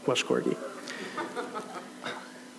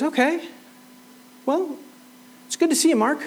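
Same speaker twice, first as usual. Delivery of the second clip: breezy, much higher goodbye to a friend; cheery farewell; 11 semitones higher than usual. Retold or imitated. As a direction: wave someone off warmly in passing. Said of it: "He goes".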